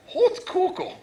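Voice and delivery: Hot Cocoa hooted high and loud; mock pronunciation. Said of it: "Alan gave me that last night".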